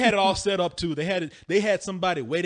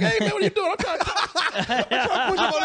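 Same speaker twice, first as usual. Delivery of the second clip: put-on drunk protest; 10 semitones higher than usual. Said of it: "And I was like".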